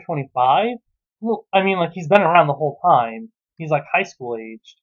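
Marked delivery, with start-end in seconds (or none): none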